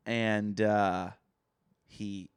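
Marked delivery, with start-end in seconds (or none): none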